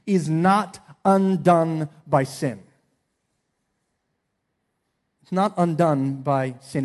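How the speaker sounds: slow, with not hit hard; not undone, not stressed and drawn out. Shown as a demonstration. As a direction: lean on not: is not undone by sin. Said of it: "Here's the statement".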